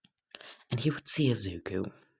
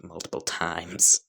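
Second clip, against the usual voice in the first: usual speaking voice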